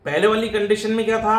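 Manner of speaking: usual speaking voice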